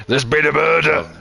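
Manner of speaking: a giggly mock Scottish "There's been a murder"; laughing mock Scottish. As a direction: take a laughing stab at the Scots line. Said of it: "that's a Jimmy Carr reference".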